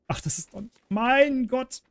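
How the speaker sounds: usual speaking voice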